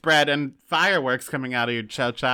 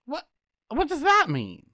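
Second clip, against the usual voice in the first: baffled what; 10 semitones higher than usual. Real, not acted. Shocked at husband's [name] remark.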